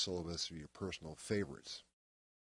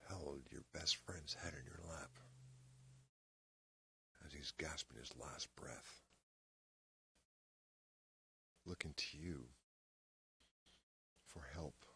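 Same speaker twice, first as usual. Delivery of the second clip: hushed grief; 7 dB quieter than usual. Acted. Acted monologue, a friend dying.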